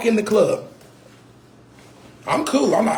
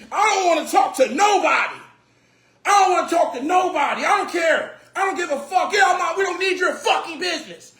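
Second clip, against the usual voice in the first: imitating a furious club owner's shout; angry yelling; 7 semitones higher than usual. Retelling the club owner yelling at his guests, mock furious shout. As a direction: yell it high like a belligerent owner. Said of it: "you came out wilding".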